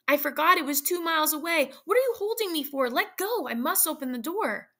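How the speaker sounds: high, urgent and rising; frantic pleading. Acted. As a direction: fight free of his grip. Mother struggling to reach door.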